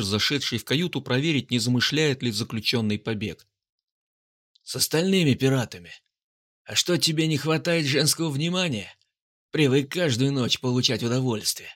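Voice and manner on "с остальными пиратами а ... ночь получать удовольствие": lightly voiced mocking soldier; a hint of a smirk in the taunt; mocking sneer. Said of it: "с усмешкой спросил он".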